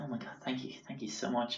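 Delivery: hushed, relieved whisper; whispered thanks. Retold or imitated. Grateful diners thanking the waiter, in a soft whisper. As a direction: breathe the thanks out softly.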